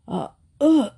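awkward stammer and throat clearing. A narrator's reading. Man chokes on soapy lemonade.

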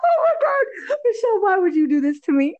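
high laughing cry of embarrassment; laughing protest. Real, not acted. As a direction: squeal through laughter at the question. Embarrassed by size question.

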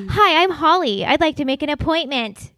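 high polite phone voice; sweet fake phone manner. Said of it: "my voice gets so fake on the phone".

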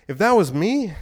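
ordinary delivery

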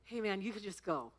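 a beaten-down actor quietly letting her off; weary clown. Retold or imitated. The clown actor quietly waving her out, low and deadpan. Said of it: "he goes".

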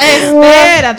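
loud, stretched-out halt; astonished interruption. Real, not acted. Host halting friend's shocking story, astonished interruption. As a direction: cut in, dragging the word out loudly.

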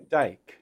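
"take" with the T nearly a D, vowel toward "eye"; South African "take", barely exploded T; unaspirated T. Shown as a demonstration. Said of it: "Instead, it's more".

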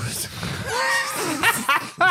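high shrieking laugh. Real, not acted. Huge laugh.

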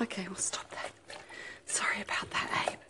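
hushed whispered apology. Real, not acted. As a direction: whisper the sorry. Apologizing after noise.

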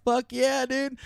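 high, loud mock cheer; hyped cheer. Nephew and aunt react to grandma's dare, high excited cheer. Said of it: "We're like".

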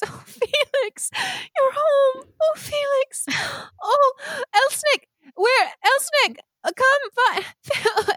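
weep with joy as you speak; happy crying. Acted. Mother sobbing with joy at her son's return. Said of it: "you can tell that she's crying".

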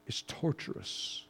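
a breathless whisper of the word torturous. Real, not acted. Preacher confesses his own cave to the church, whispered.

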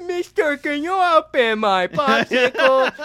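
very high, slow, pleading put-on voice; a comic pretending to be helpless to gangsters, high and slow; mock helpless voice. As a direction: stretch it out, playing dumb.

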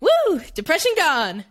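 high, slow cheer; hammed-up triumphant woo; mock-cheering after tennis; mock elation. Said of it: "I was like".

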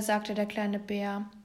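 ordinary delivery, nothing marked

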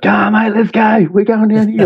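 high-pitched mock Aussie holler; hammed-up Aussie calling out to mates. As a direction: put on a rowdy Aussie, way up high. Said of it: "this Aussie comes out".

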